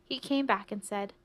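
ordinary delivery, nothing marked

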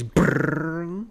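rolled brrr of Roadrunner taking off; cartoon Roadrunner noise. Podcaster dashing to the door, cartoon brrr sound. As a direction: trill it like a cartoon getaway. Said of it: "it sounds like a cartoon, like, you know, Roadrunner".

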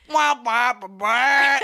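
loud wah-wah horn sounds; imitated trumpet noise. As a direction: play a sad trombone with your mouth. Imitating trumpet-like fart jokes.

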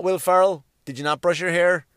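ordinary talk, nothing marked

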